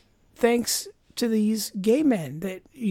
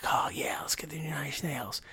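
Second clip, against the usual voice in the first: very soft, half-whispered imitation; hushed mock approval; 3 semitones lower, 13 dB quieter than usual. Imitating muscle-bound record shoppers picking up an album, hushed. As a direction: imitate approving musclemen under your breath.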